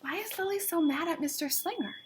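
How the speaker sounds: quick soft question. A narrator's reading. Reader quizzing the children about Lily's anger, softly. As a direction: ask the class in a quick hush.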